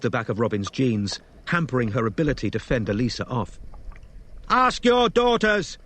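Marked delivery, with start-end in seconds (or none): 4.5-5.8 high, loud, slow shout. A narrator's reading. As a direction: shout it over the fight. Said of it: "shouted Robin".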